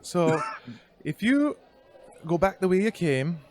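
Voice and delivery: hammed-up Irish cat lady; high-pitched Irish woman. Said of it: "high-pitched Irish female voice".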